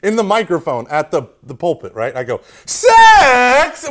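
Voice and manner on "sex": loud, high, horribly whiny and nasal blurt; mock your young self with a nasal yell. Student speaker blurting into the pulpit microphone, whiny nasal voice. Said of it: "with this horrible, whiny, nasally voice".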